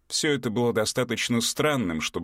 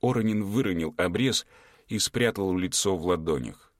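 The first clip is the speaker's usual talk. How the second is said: ordinary delivery, nothing marked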